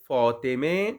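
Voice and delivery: فاطمے said slowly with a final "e" sound, Faa-te-me; Persian pronunciation. Shown as a demonstration. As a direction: stretch فاطمے and end it on a clear "e". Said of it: "اس سے پہلے زیر دے کر تلفظ کریں گے". (فاطمے کو آخر میں زیر کے ساتھ آہستہ تلفظ)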